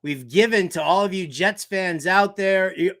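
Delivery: ordinary talk, nothing marked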